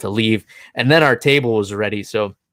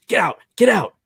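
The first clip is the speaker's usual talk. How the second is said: higher, repeated sharp dismissal; retold heated command; mock angry order; 7 semitones higher than usual. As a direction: imitate an irate older man ordering someone out. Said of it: "just lost his shit".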